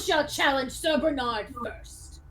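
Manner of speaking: ringing formal challenge; grand, declamatory knight; proclaiming knight. Acted. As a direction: lay down the law like a proud duelist. Game master plays the skeleton knight.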